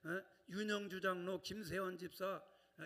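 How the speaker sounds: ordinary delivery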